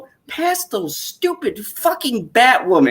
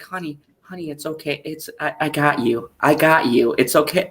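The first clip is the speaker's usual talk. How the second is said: mock comforting wife; 3 semitones lower than usual. Wife comforting her sobbing husband, hushed and tender. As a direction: soothe him like a crying child.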